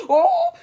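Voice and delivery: put-on a sudden high-pitched child's shriek; high squeal of a crying child, played for laughs; mock high scream. Imitating her screaming as a child.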